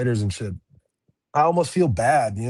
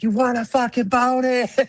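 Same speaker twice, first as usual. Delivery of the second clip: excited yell; 13 semitones higher, 4 dB louder than usual. Retold or imitated. Cousin yelling news at bar.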